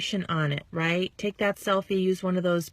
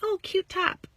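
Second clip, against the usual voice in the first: sample compliment; 10 semitones higher than usual. Shown as a demonstration. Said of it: "Comment on the hair".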